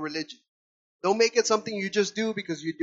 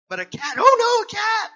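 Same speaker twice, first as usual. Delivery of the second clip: much higher and louder, sarcastic cry; mock outrage; 13 semitones higher, 8 dB louder than usual. Real, not acted. Preacher scoffing at neighbors fussing over a cat, loud mock alarm. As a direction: shout it in mock horror.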